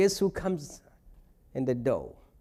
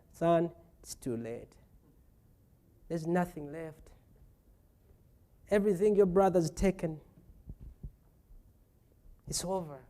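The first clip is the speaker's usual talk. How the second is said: softer, low, weary father; quiet resignation; 4 semitones lower, 7 dB quieter than usual. Retold or imitated. Father telling his son the blessing is gone, soft and resigned. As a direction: let him down gently, resigned. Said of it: "It's like".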